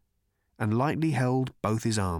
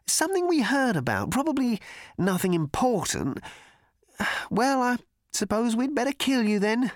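put-on higher, hesitant apologetic young man; uneasy cricketer; 13 semitones higher than usual. A narrator's reading. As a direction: shrug off the killing awkwardly. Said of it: "continued the cricketer uneasily".

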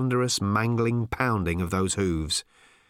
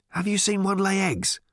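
suspicious; 8 semitones higher than usual. A narrator's reading. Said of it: "said Ford suspiciously".

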